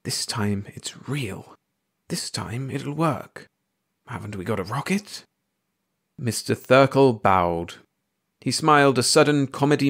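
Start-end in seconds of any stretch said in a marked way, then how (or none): 0.0-5.2 hushed whisper. A narrator's reading. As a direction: whisper it to yourself with your voice still in it. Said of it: "she bit at the suspicion and whispered".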